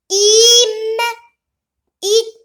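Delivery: Im and it called out loud, one by one; loud classroom read-aloud. Shown as a demonstration. Teacher leading the class in reading, called out loudly. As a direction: call out Im, it for the class. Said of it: "lisez avec moi".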